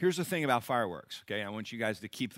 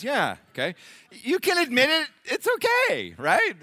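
playful, much higher sing-song coaxing; teasing coax; 14 semitones higher, 8 dB louder than usual. Acted. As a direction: tease them into admitting it, voice way up. Coaxing the pyros to admit it.

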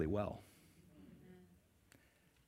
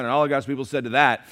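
normal talking voice

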